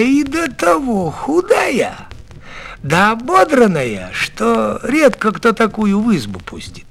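usual speaking voice

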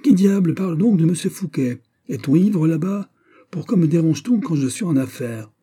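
high, exasperated yell; desperate shouting. A narrator's reading. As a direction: shout down angrily at whoever interrupted. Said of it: "cria-t-il désespéré".

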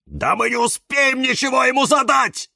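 high, loud angry outburst; barking. A narrator's reading. Cactus rejects the idea of questions, high angry bark. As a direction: bark back in outrage. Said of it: "рявкнул кактус Кирилл".

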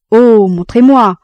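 loud, slow mock plea; hammed-up begging to be shown; feigned despair. A narrator's reading. Jean feigns helplessness to get close, loud mock plea. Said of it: "simulait un grand désespoir".

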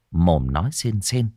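usual speaking voice

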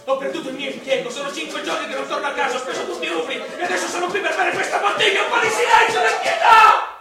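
builds from loud to a full shout; loud despair rising to a shout; desperate outburst. Acted. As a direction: cries out for silence, beside himself. Drunk pleading in tavern.

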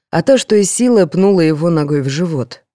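ordinary delivery, nothing marked

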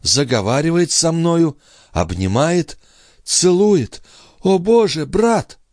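high character voice, climbing; agitated sister. Acted. Sister tells brother of the king's embrace, high and agitated. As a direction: build to a high cry to her brother.

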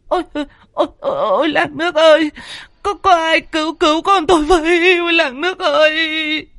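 high-pitched wailing cry for help; panicked screaming. Acted. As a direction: scream tearfully to rouse the whole village. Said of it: "hét ầm cả lên". (la hét thất thanh, khóc kêu cứu)

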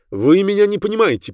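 high-pitched agitated protest; interrupting. A narrator's reading. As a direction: cut in, distraught. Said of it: "перебил он меня".

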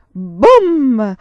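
a booming blast, shouted out; loud boom. A narrator's reading. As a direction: burst out with the cannon's boom. Narrator voices the explosion.

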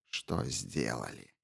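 low, quiet, slow bitter scoff; quiet, venomous mocking echo; bitter sneer. A narrator's reading. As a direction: sneer the words back with bile. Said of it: "Желчно усмехнулась чародейка".

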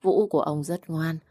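usual speaking voice

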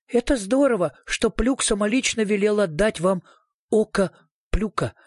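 lightly voiced skinny little fellow; thin little man. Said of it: "проговорил худой человечек".

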